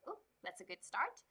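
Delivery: ordinary delivery, nothing marked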